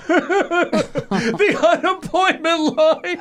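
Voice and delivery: stretched-out, giggling punchline; laughing punchline. Retold or imitated. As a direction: deliver the joke while cracking up. Joke told to cab driver.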